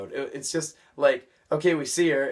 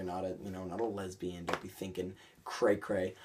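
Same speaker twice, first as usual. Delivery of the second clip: much softer, mumbled under the breath; quiet aside; 6 semitones lower, 10 dB quieter than usual. Real, not acted. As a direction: drop the voice for a sly joke. Muttered joke aside.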